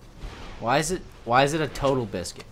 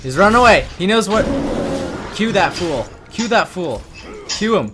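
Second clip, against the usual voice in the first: raised, loud, short repeated bursts; urgent excited calls; 5 semitones higher, 6 dB louder than usual. Real, not acted. Player begging teammate for the kill, excited and urgent. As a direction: call out frantically in the chase.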